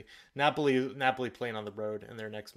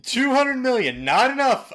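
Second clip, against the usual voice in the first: much higher and louder disbelief; can't believe the figure; incredulous; 12 semitones higher, 12 dB louder than usual. Real, not acted. Co-host balking at the contract talk, loud disbelief.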